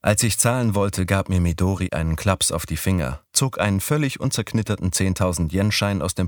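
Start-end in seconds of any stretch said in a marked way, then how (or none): none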